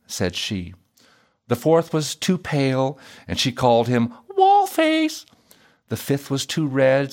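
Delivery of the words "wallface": high princess voice for the nickname; mocking princess. A narrator's reading. Princess naming a too-pale suitor, mocking. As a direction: toss out a high-pitched insult.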